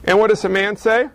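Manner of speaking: usual speaking voice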